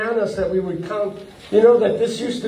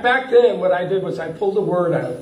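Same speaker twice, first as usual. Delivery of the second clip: normal talking voice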